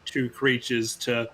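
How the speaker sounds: normal talking voice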